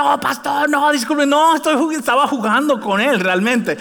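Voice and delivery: high, flustered voice of embarrassed friend Robert; flustered apology. Retold or imitated. Robert caught out, apologizing to the pastor, high and flustered. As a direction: squeak out a nervous apology. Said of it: "el pobre Robert".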